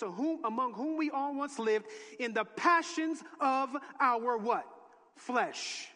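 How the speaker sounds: rising preacher's cadence; preaching. Acted. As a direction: preach it with force. Preaching at full force.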